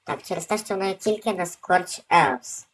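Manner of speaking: ordinary talk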